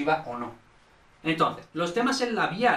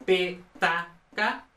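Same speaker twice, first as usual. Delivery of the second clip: petaca very slowly, broken into pe, ta, ca; syllable by syllable; 2 semitones higher, much slower than usual. Shown as a demonstration. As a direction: spell out petaca in separate syllables. Mnemonic split into syllables. (petaca, sílaba por sílaba, muy despacio)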